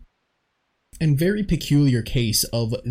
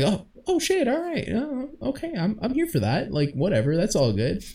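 much higher, startled and then shrugging it off; caught off guard; 8 semitones higher, 3 dB quieter than usual. Retold or imitated. Host imitates a viewer startled by the narrator's smooth penis, caught off guard. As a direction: jolt up high in surprise, then settle. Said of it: "And you're like".

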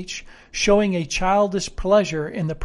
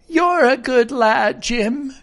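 slow, higher sailor voice; kindly old sailor, pitched up; approving castaway character; 7 semitones higher, 4 dB louder, slower than usual. Acted. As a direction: praise the boy as a rustic castaway.

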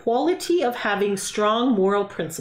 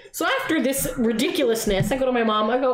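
ordinary talk, nothing marked

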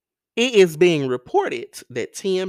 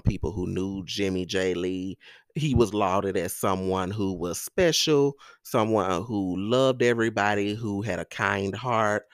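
usual speaking voice

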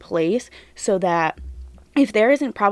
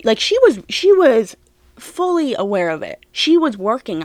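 heated; 3 semitones higher, 5 dB louder than usual. Real, not acted. Heated defence of the wife.

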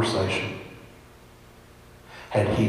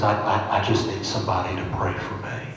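normal talking voice

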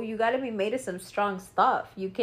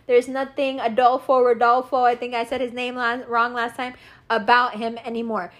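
ordinary delivery

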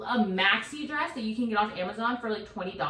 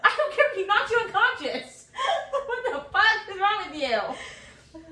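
furious yell; 9 dB louder than usual. Retold or imitated. Mom yelling at husband.

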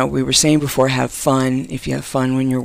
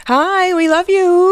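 excited greeting; 6 dB louder, slower than usual. Real, not acted. Gushing greeting to caller.

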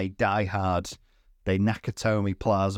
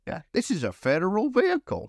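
higher, pompous mock tone; mock officious; 9 semitones higher than usual. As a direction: play the fussy official. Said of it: "He's like".

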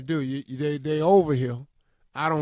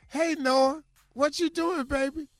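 sweet grandma voice; 10 semitones higher than usual. Retold or imitated. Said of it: "she says".

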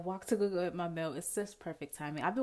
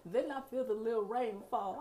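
ordinary delivery

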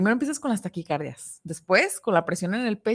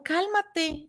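higher, curt mock order to calm down; dismissive calm down; 9 semitones higher, 3 dB quieter than usual. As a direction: imitate someone brushing off the anxiety. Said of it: "o te ven alterado".

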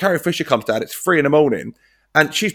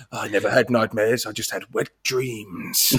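lower, softer, slow sleazy drawl; quoting sleazy Murray; creepy old man voice; 6 semitones lower, 8 dB quieter than usual. Retold or imitated. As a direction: purr it like a creepy older man.

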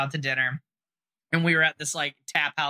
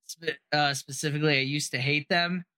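ordinary delivery

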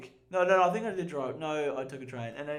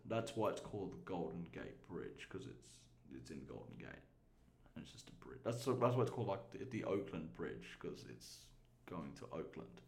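trailing off; 10 semitones lower, 12 dB quieter than usual. Real, not acted. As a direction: voice fading into near silence. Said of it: "That was really anticlimactic".

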